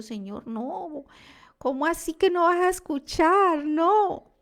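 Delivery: high, loud cry of alarm to the Lord; desperate plea. Retold or imitated. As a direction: beg God not to stop listening, rising into a loud cry. Pleading with God in prayer.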